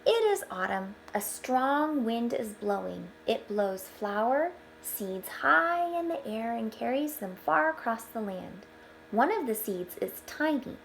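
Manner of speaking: bright storybook read-aloud; gentle storyteller voice; reading to children. Acted. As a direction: read it out like a picture book for the class. Reading picture book aloud to children.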